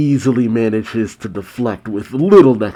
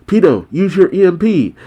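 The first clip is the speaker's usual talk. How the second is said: high, loud urgent shout; 8 semitones higher, 5 dB louder than usual. A narrator's reading. Happy to unconscious Pito, shouting. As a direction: call out the order, raised and louder.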